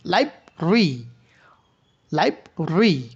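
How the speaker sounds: লাইব্রেরি in two separated syllables, "laib... ri"; shortened pronunciation. Shown as a demonstration. Teacher repeats for students, লাইব্রেরি shortened to "laib-ri". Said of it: "উচ্চারণটাকে সংক্ষিপ্ত করা হয়".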